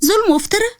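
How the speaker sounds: high rising incredulous question; indignant high-pitched retort; indignant echo. Acted. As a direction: throw it back in disbelief, voice lifting. Throws the accusation back as question.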